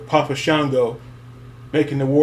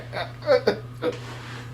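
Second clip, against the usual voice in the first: a high, airy, breathy laugh; soft breathy giggle; breathless laugh; 11 semitones higher, 8 dB quieter than usual. Real, not acted. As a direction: let the laugh escape. Laughing at memory.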